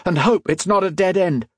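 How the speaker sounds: high, pressing delivery; urgent order. A narrator's reading. Modo leading friends into the unknown passage, high urgent order. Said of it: "Modo ordered".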